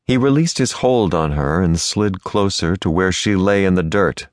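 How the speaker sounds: ordinary delivery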